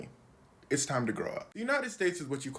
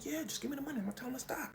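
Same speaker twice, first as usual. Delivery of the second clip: whispered, much softer, higher; mock Trump whisper; 10 semitones higher, 8 dB quieter, much faster than usual. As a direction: whisper it like a sly secret deal. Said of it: "Donald Trump saying".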